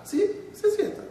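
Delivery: put-on squeaky high young woman; high girl voice. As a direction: jump way up into a girl's voice.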